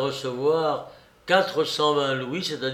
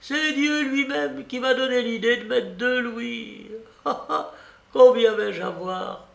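put-on high, delighted old lady with a laugh; gleeful, high-pitched chatter; lively and radiant; 9 semitones higher than usual. A narrator's reading. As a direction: gloat happily in a raised voice. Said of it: "dit vivement la babouchka toute rayonnante".

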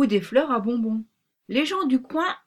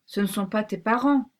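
ordinary delivery, nothing marked

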